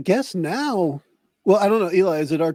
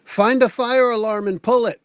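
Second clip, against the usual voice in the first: raised, higher urgent call; shout through window; 5 semitones higher, 4 dB louder than usual. Acted. Player shouting through the window at Mars, acted shout. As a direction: call out an order to a teammate. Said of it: "I shout through the window".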